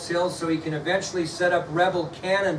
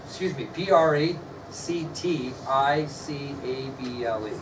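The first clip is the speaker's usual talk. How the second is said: slow letter-by-letter spelling, a little softer; 3 semitones lower, 4 dB quieter than usual. Shown as a demonstration. Teacher spelling a word for students, slow and soft. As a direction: spell practicable slowly, one letter at a time. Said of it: "I'll spell that for you".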